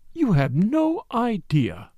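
slow, soft, dreamy; soft and wistful. Acted. Lady Tamplin dreaming of newspaper money, soft and wistful. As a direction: sigh it out longingly. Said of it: "in a soft, wistful voice".

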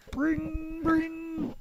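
high sung phone ring; mock ringing phone, high. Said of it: "phone ringing sound".

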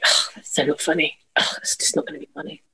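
quiet mock sulk; sulky grumble. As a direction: grumble it under your breath. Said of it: "I'll be like".

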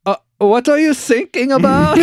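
high, loud mock German, "thinking" said as "sinking"; clueless question in a hammed-up German accent. German coast guard misunderstanding a mayday, baffled German accent. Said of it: "this is the German Coast Guard".

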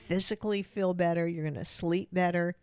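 usual speaking voice

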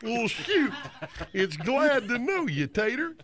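slow, hammed-up cowboy drawl; exaggerated, drawn-out cowpoke; bad cowboy. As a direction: stretch every word like a corny movie cowboy. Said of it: "I'll do a bad cowboy".

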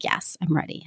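unvoiced, barely audible whisper of a reply; whispered yes. Client in the car whispers that she is ready, unvoiced whisper. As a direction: breathe the answer out with no voice.

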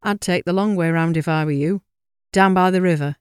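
put-on elderly countryman with a northern English accent. A narrator's reading. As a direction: warn them in a northern English voice. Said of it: "with an accent from further north".

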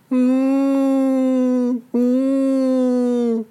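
high, drawn hum of pain; two high groaning hums; high pained moan. Acted. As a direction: moan like a hurt dinosaur. Injured T. rex moaning.

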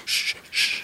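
soft breathy shushing; whispered shush. Acted. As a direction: hush them in a whisper. Birdwatchers hushing each other.

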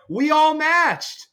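high, slow mock-proud announcement; drawn-out proud line. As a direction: stretch it out high and slow, beaming. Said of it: "And I was like".

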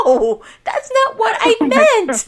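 high, loud flustered denial; flustered protest. Retold or imitated. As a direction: blurt it out, embarrassed. Retold embarrassed protest.

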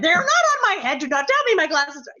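high, loud, opinionated fussing, hammed up; Catherine McGregor. Actress imitating her co-star hunting her glasses, loudly opinionated. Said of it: "she was very opinionated".

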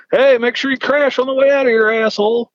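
high-pitched mock yell; imitated heckling. Arrested guy's dad heckling the departing officer, mock angry call.

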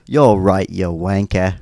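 loud mock British insult; hammed-up British accent; put-on British accent. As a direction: play at being British, cheekily. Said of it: "you gotta be from Britain".

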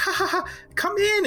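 very high, forced laugh into a welcome; nervous maid's laugh. Acted. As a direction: laugh it off as the flustered maid. Nervous maid covers with a laugh.

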